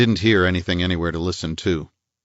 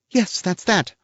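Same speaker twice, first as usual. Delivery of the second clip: the lady's bright, higher reply; woman's voice, much higher; voiced woman; 13 semitones higher, slower than usual. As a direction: echo it pitched up as the lady. Woman figure answers.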